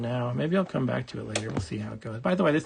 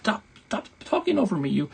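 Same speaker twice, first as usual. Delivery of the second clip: mock annoyed; 7 semitones higher than usual. Scolding the game's voice.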